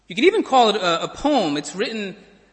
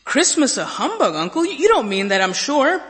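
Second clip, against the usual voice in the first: incredulous nephew; 6 semitones higher, 5 dB louder than usual. Acted. Nephew answering Scrooge.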